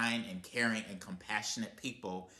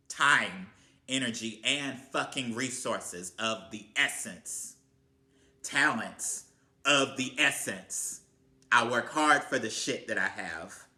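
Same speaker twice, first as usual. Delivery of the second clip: louder, rising angry outburst; fed up rant; 3 semitones higher, 6 dB louder than usual. Real, not acted. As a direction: let the frustration boil over. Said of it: "when a person like me comes at you like this".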